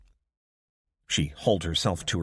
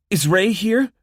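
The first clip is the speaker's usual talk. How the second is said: much higher, loud urgent question; anxious call; 13 semitones higher, 10 dB louder than usual. A narrator's reading. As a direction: call out desperate for news. Abby calls anxiously.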